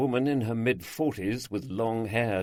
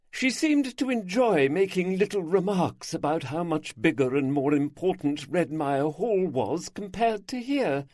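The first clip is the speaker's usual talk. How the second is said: a little higher, chatty and confiding; a hint of a lively older lady; lightly voiced gossiping hostess; 10 semitones higher, 5 dB louder than usual. A narrator's reading. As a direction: gossip cheerfully about the neighbours. Isabel speaking in the story.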